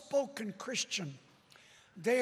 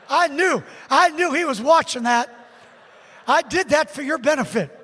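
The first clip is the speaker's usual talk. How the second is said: very loud preacher to the congregation; booming pulpit delivery; forceful preaching; 4 semitones higher, 14 dB louder than usual. Real, not acted. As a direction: belt it out to the whole room.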